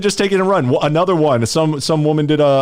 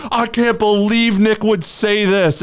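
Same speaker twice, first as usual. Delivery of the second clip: mock outrage; 8 semitones higher, slower than usual. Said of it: "everybody screen capped that and was like".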